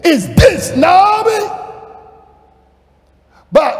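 high, loud, chant-like sermon building to a shout; full-force preaching. Acted. Preacher proclaiming pleasantness has come home, full-force preaching. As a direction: pile up each phrase, rousing the congregation.

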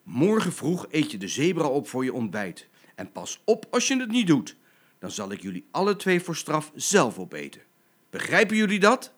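stern mother lion laying down the law; threatening parental ultimatum; angry threat. Acted. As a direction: build to a loud, high final demand. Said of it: "Maar moeder Leeuwin zei nog meer".

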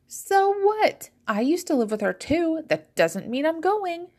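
high, indignant comeback; dismissive refusal. A narrator's reading. Fizzy refuses the funeral.

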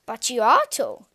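loud, matter-of-fact answer from the prince; prince's answer. A narrator's reading. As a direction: state it plainly and loudly. Prince's reply.

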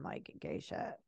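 low unvoiced whisper. Real, not acted. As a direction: whisper it like a secret.

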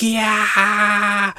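high, drawn-out mock scream; imitated screaming. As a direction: scream like shocked cartoon faces. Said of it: "They're screaming".

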